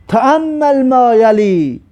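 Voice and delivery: trek de kop omhoog en rek elk woord. Shown as a demonstration. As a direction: lees de opdracht plechtig en langzaam voor. Teacher reads exercise heading.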